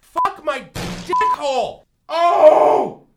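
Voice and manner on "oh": pained shout. Acted. Pepper agony.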